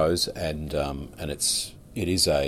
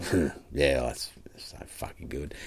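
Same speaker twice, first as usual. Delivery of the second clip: imitating a hushed, awed whisper; 2 semitones lower, 5 dB quieter than usual. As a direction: breathe it out as if stunned by the sound. Quoting Ralph's awed reaction.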